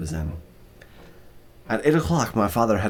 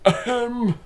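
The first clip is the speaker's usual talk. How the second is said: polite throat clear. A narrator's reading. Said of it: "Francois said".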